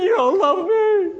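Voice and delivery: high, slow, whimpering wail; hammed-up sobbing wife voice; mock tearful wife. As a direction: imitate a wife whining through tears. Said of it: "she's in tears".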